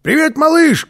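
very high, loud call; high-pitched holler across the roof; shouted greeting. A narrator's reading. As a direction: call out to a friend far off. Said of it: "крикнул он".

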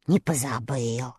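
sighing admission. A narrator's reading. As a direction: admit it with a sigh. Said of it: "со вздохом признался Страшила".

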